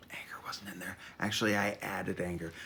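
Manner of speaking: hushed unvoiced aside while reading the blurb; a quick whispered confession. Real, not acted. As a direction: drop to a whisper for a private correction.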